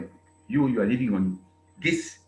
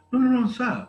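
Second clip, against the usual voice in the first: mock official protest; 12 semitones higher, 6 dB louder than usual.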